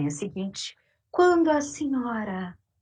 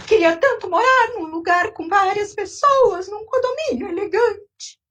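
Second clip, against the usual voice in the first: put-on much higher, loud whining old woman; wailing old lady; 12 semitones higher, 4 dB louder than usual. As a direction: wail like a self-pitying old lady. Old lady laments alone.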